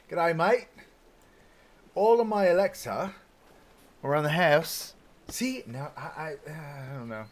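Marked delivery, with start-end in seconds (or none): 0.1-4.9 hammed-up high, loud Australian accent. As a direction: do a cheesy Aussie accent. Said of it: "I'm horrible with accents".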